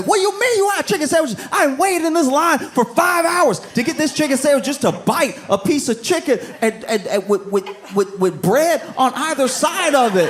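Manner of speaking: high, fast, shrill-pitched mock shouting; angry sandwich customer. Comedian mocks customer who waited hours, high mock yelling. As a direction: squeal it like a customer losing his mind in line.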